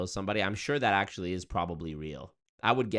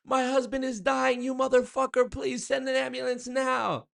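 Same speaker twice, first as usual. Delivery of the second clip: furious desperate caller; 5 dB louder than usual. Pretend. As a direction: yell at the useless bot.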